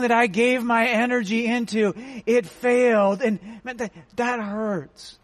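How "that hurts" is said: ordinary delivery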